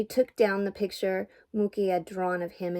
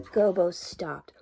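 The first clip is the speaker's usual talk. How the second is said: usual speaking voice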